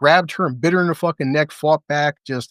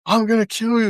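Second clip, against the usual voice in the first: higher, repeated mock yell; mild mock yell; 8 semitones higher than usual. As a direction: raise the threat a notch. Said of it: "yells it again".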